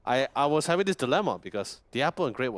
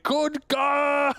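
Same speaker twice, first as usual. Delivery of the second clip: high, loud shouted name; Tidehunter scream; 5 dB louder than usual. Retold or imitated. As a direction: bellow the name like a furious sea giant. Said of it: "pretty sure he's still screaming".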